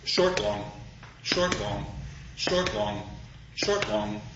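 low, measured pulse, "short" brief and "long" held; iambic heartbeat. Shown as a demonstration. Lecturer imitating our heart's pulse, "short, long" in steady iambs. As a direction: beat out "short, long" like a heart, pausing after each pair. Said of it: "is quite literally iambic".

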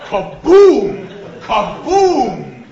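huge, high, loud "kaboom"; booming explosion. Shown as a demonstration. Lecturer showing the meter blowing up, booming explosion. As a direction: explode on a long drawn boom.

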